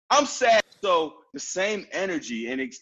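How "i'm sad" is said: high, loud, cheerful announcement of sadness; upbeat about being sad. Acted. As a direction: declare sadness with happy energy. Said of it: "Have that same energy when you're sad".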